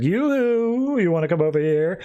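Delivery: mock sing-song call with a high yoo-hoo; lightly voiced taunting villain. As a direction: call out teasingly, like a taunting Joker. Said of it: "he just basically starts taunting Harley".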